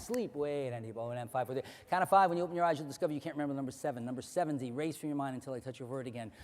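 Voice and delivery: soft, low hypnotist voice; hypnotic suggestion. Acted. Hypnotist erasing a number from a volunteer's mind, soft. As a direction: slip the suggestion in quietly.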